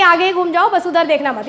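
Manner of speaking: ordinary talk, nothing marked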